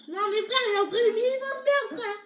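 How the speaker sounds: loud, climbing pitch; alarmed outburst. Pretend. Player warns of a real enemy, loud rising panic. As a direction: cry out with mounting alarm.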